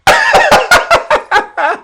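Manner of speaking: high-pitched burst of laughter; a high, derisive laugh; mocking laugh. Real, not acted. Mocking laugh at the quoted visitor.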